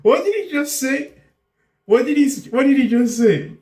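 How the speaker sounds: high-pitched stunned disbelief; incredulous. Real, not acted. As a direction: ask it high, as if you can't believe your ears. Stunned reaction to played clip.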